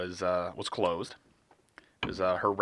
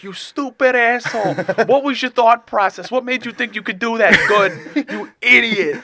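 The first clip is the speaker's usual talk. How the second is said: high, loud, laughing outrage; mock tirade; 12 semitones higher, 8 dB louder than usual. Imitating a friend who circles back to berate him, high mock rage. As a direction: berate him in hammed-up disbelief.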